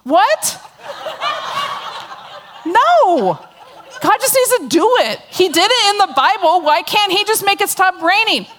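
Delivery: mock indignation at her husband; indignant protest. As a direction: refuse the idea, sound exasperated. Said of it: "And I'm like".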